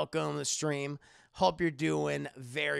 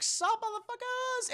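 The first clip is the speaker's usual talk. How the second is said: much higher cartoon baby voice; baby Jesus voice. Baby Jesus greeting Yusuke and friends in a squeaky baby voice. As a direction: greet them like a cocky infant god.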